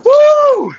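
very high, loud, held "woo"; big workout whoop. Real, not acted. Instructor during the last rep, big whoop.

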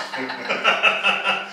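bursting into a high laugh; real laugh. Real, not acted. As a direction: laugh out at the joke. Laughing after joke.

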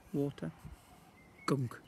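ordinary delivery

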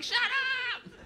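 very high, stretched-out shriek; comic sleepless fury; exasperated scream. Acted. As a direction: screech it like someone kept awake. Yells again at the music.

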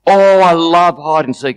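acted delight. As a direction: burst out like a thrilled child. Shame thrilled by hide and seek.

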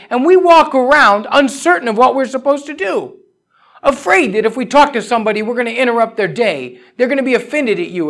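loud, high, urgent sermon climb; full-force preaching. Acted. Preacher telling believers they hold the answer, full-force preaching. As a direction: preach it out, pressing every line.

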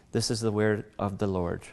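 normal talking voice

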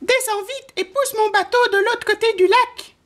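very high, squeaky mouse voice; little mouse crying out. A narrator's reading. Mouse ordering the wind to push his boat, high-pitched cry. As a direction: pipe up as a tiny mouse giving orders. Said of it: "s'écria le souriceau".

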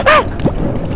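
high, sudden imitated cry; imitated creature noise. Shown as a demonstration. Player showing a friend how they sounded, imitated noise. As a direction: mimic the sound with a short high yelp. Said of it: "they used to sound like".

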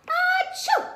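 big high loud sneeze; a huge theatrical achoo. Acted. Sneeze in a children's story.

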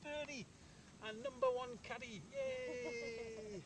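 high, joyful cheer; excited. Real, not acted. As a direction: celebrate the putt going in. Celebrates a birdie putt.

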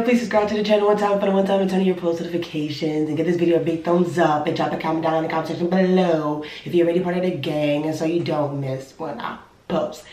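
normal talking voice

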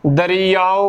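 ਦਰਿਆਓ in full, "da-ree-yaa-o"; correct pronunciation. Shown as a demonstration. Teacher showing every vowel sign, careful pronunciation. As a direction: sound the final o of ਦਰਿਆਓ. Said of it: "ਬੋਲਣਾ ਹੈ".

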